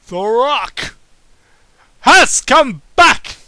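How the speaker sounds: loud, slow, booming ring call; mock wrestling announcer. Reader hamming up a wrestling-announcer boom.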